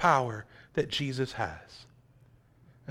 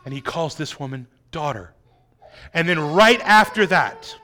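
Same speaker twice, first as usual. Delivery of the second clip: ordinary delivery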